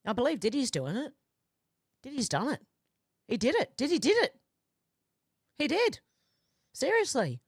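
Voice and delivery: playful mock outrage climbing to a shout; mock accusation. Joking accusation building up.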